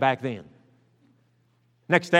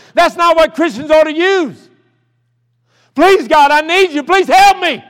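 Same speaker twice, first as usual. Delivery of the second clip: building from raised voice to high, loud pleading; desperate prayer; 10 semitones higher, 9 dB louder than usual. Retold or imitated. New convert begging God for forgiveness, desperate pleading. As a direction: beg God with mounting force, pitch climbing.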